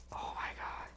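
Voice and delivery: awed whisper. Real, not acted. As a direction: mouth it with a breath of awe. Gushing over craft dies.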